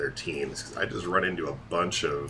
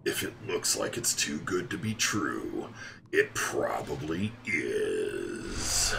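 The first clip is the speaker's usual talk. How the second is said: mock trailer tagline; 4 semitones lower, 4 dB quieter than usual. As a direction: deliver it as a grave trailer tagline.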